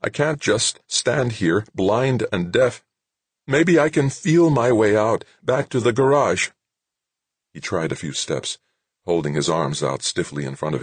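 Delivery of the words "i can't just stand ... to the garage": desperate soldier voice, higher; trapped and scared, pleading; panicky young trooper. A narrator's reading. Trooper panicking inside his helmet.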